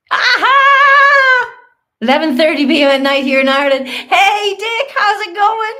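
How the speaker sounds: loud, high, fast Irish greeting; excited Irish accent, laughing a little. Real, not acted. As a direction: burst out in a chirpy Irish accent. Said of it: "suddenly an Irish accent comes out".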